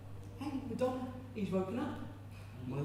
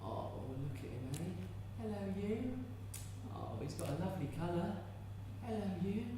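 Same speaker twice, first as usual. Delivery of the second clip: put-on tender baby talk; 2 semitones higher, 8 dB quieter than usual. Mother cooing to a baby.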